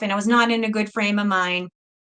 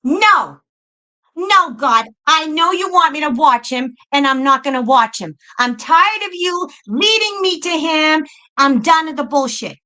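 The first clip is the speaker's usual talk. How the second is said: re-enacted fed-up refusal, pitched up; heated retold backtalk; defiant rant at God; 5 semitones higher, 3 dB louder than usual. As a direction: argue back at God, emphatic and exasperated. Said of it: "This is how I talk to God".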